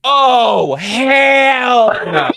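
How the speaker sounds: loud, high, drawn-out shout; outraged snap. Retold or imitated. Teacher turns on girl who shamed classmate, outraged shout. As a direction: explode in outrage, stretching the words. Teacher snaps at embarrassing pupil.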